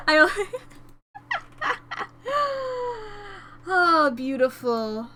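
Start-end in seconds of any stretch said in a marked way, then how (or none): 1.1-3.4 high giggling laugh trailing into a long squeal. Real, not acted. Streamer overcome by the ending, high helpless laugh.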